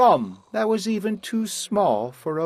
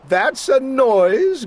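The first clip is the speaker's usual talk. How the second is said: grinning Grinch; 9 semitones higher, 5 dB louder, slower than usual. Acted. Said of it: "grinned the Grinch".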